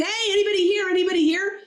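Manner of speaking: high, urgent acted call for whoever is around; high-pitched, agitated hollering in a joke; frantic calling out. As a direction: call out as the joke's customer losing his head. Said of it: "starts to go crazy".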